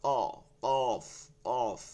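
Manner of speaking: "কফ" thick and heavy, held long; heavy push. Shown as a demonstration. Teacher drilling the thick letter, heavy push. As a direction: push out "কফ" with weight. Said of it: "ধাক্কা একটু ভারী দিয়ে".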